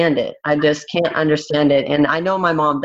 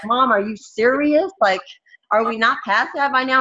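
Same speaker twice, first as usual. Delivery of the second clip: exasperated; 7 semitones higher than usual. Retold or imitated. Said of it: "And I'll be like".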